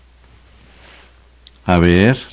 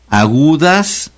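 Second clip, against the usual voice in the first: Agudas slow, drawn out, rising high at the end; drilled key term; 6 semitones higher, slower than usual. Shown as a demonstration. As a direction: stretch Agudas and lift it, leaving it hanging. Teacher drills the rule. (Agudas, lento y subiendo, como en un dictado)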